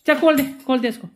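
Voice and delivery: loud, high commands to go out; shooing out. Real, not acted. Mother loudly shooing the dog outside. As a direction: shoo the dog out the door.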